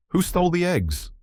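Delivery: high-pitched quarrelsome goat; mock taunt. A narrator's reading. Billy goat challenging the pig, mock taunt. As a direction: needle him with a high accusing question.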